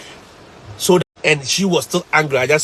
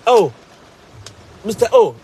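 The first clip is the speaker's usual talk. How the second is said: student voice; 7 semitones higher, 4 dB louder than usual. Retold or imitated. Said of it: "they say".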